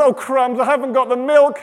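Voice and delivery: high, loud flustered dismay; dismayed realization. Acted. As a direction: suddenly realize what you forgot, loudly. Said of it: "she gets back and realizes".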